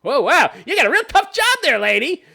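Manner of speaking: high, loud mock-impressed sarcasm; sarcastic. Real, not acted. Mocking the stay-at-home mom's tough job, high sarcasm. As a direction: pretend to be impressed, dripping sarcasm.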